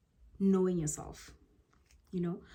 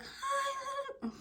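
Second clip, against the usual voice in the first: high, small, soft greeting; a meek little hi; not assertive at all, tiny high voice; timid squeaky hi; 9 dB quieter than usual. Shown as a demonstration. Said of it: "that's not assertive".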